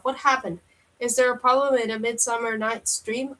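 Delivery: ordinary delivery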